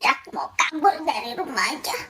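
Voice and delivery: sulky mutter. Acted. Son mutters threat, then repeats it.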